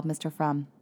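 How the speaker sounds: plain reading voice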